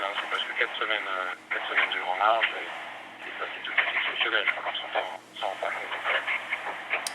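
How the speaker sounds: fading, broken satellite call; breaking phone line. Real, not acted. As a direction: voice dropping out on a bad line. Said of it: "la communication téléphonique est de moins bonne qualité".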